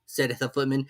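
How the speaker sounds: usual speaking voice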